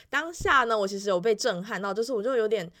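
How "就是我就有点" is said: normal talking voice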